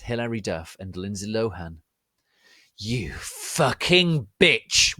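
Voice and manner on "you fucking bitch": slow, louder furious yell; angry shout. Acted. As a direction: spit the insult out slowly in rage. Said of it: "I shouted angrily".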